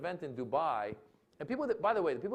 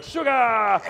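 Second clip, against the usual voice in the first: loud, high echo of an order; crew shouting back; 8 semitones higher, 14 dB louder than usual. Retold or imitated.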